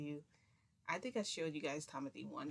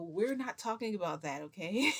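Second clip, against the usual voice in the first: raised, loud cutting-off; loud protest; 4 semitones higher, 9 dB louder than usual. Retold or imitated. Storyteller stops the men discussing her, loud protest. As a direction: shut the topic down insistently. Retold embarrassed shutting down topic.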